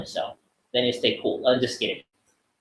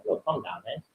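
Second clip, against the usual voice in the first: calming self-talk; 2 semitones lower, 11 dB quieter than usual. Shown as a demonstration. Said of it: "Just telling myself".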